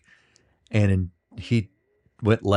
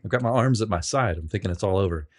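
ordinary talk

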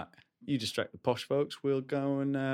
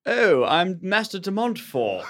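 slow, higher, hammed-up posh English; posh accent attempt; 6 semitones higher, 5 dB louder, slower than usual. Tony tries to sound posh for the party, slow and laughing. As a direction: put on a plummy aristocrat voice, laughing. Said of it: "Try and talk like us".

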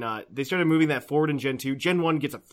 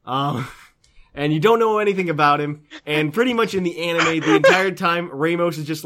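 normal talking voice

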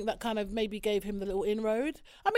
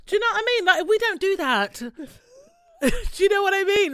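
loud, high American voice breaking into laughter; laughing mock American accent; 10 semitones higher, 9 dB louder than usual. Mocking American commenters, laughing in a loud American accent. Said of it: "sometimes they say".

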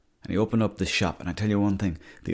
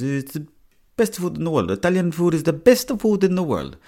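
high, loud hammed-up Italian chipper owner; mock Italian accent; 9 semitones higher, 5 dB louder than usual. Italian chipper owner boasting to the customer, mock Italian accent. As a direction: boast about Italian food in an over-the-top Italian accent. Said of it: "He goes".

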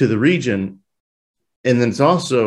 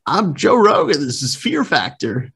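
pitched-up mock TV host intro; Joe Rogan impression; 6 semitones higher than usual. Trying an impression for his cohost, mock host announcing Fear Factor, pitched up. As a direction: announce the show like a hammed-up host. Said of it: "Try to do a".

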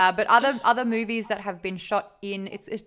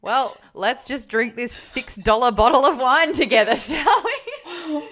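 louder and higher, breaking into laughter; laughing; 7 semitones higher, 7 dB louder than usual. Retold or imitated. Joking to the drummer over cheap wine, laughing. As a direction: offer the drink through a laugh.

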